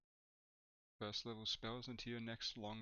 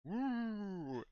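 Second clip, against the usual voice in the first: spooky woo; 11 semitones higher, 3 dB louder than usual. Retold or imitated. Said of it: "you kinda go".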